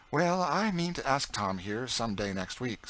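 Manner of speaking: put-on a cheeky boy egging the cook on; young George. A narrator's reading. George teasing Aunt Chloe about other cooks, boyish voice.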